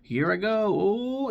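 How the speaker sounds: high-pitched doddery old-man wail, played as a joke; hammed-up old man drifting away, voice soaring; senile old man. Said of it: "like he's a senile old man".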